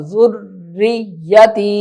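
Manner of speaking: ذریتی drawn slowly with a pressed doubled ra; careful ذریتی; slow firm shadda. Shown as a demonstration. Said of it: "زا کو را کے ساتھ". (تشدید کے ساتھ آہستہ)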